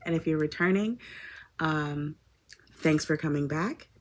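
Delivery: ordinary talk, nothing marked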